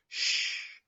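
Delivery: a long unvoiced shh to hush the class; shushing teacher. Teacher shushing a noisy class to be quiet. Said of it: "a teacher might make when they want you to be quiet".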